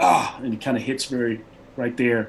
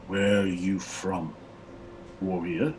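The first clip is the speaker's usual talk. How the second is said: slow, heavy warrior's question; slow barbarian question; 4 semitones lower, 5 dB quieter, slower than usual. Acted. Barbarian leader asking Flinch where he's from, slow. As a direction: weigh each word as a wary chieftain.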